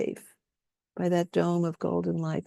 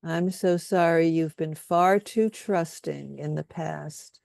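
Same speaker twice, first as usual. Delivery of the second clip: guided compassion statements. Acted. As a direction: lay each statement down gently, leaving room for echoes. Leads group in compassion statements.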